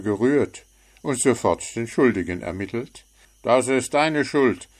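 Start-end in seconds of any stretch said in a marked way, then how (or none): 3.4-4.7 louder, higher blaming gnome; accusing. A narrator's reading. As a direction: point the finger at the bird. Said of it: "sagte er zu Zwitschi".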